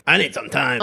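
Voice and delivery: quick huffy mock outburst; mildly acted storming-off line. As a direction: blurt it as if stomping out of the room. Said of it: "not kinda stomp out of the room".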